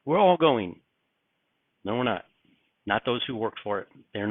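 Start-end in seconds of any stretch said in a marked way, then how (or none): 0.0-0.8 mocking everyone's easy confidence, higher and louder; mimicking the crowd's cheerful certainty, pitched up; breezy confident claim. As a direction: toss it off as if it's obvious. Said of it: "and everybody's like".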